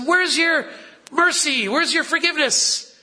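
Barnabas pleading. Acted. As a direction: appeal for mercy, pitch up.

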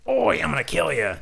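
mock Australian delinquent; Australian-sounding thug. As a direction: growl the threat like an Aussie tough. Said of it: "Okuyasu went from".

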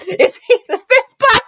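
high, giggly question about the vampire; laughing question. Real, not acted. Host laughing as she asks about the vampire. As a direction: ask it through a laugh.

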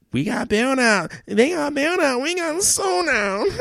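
mock wailing. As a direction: wail it out like a fake sob. Mock anguished wail about bailouts.

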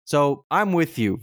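ordinary talk

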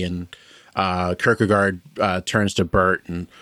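ordinary talk

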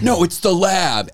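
slow, high know-it-all; insistent mock voice. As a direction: drag out the correction. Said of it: "everyone's like".

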